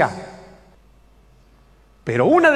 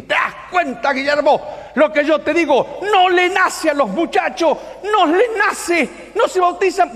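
high, indignant outburst; exasperated elder; 9 semitones higher than usual. Retold or imitated. Elder complains to Guillermo about the youths, exasperated high outburst. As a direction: imitate an old church elder protesting in a high, strained voice. Anciano stands up, protesting to Guillermo.

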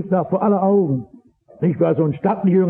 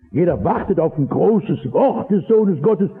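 ordinary talk, nothing marked

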